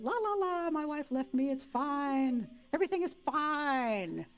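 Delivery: high, sing-song cheery denial, slowing down. Retold or imitated. Ted alone in his apartment, high forced cheer. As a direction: chirp it out like nothing is wrong.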